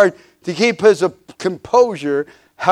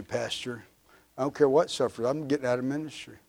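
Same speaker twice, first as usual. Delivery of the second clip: much softer, mock indifferent church member; quiet dismissive quitting; 3 semitones lower, 12 dB quieter than usual. A member quietly tells the pastor he's quitting ministry, dismissive. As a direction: toss it off quietly, like you're done.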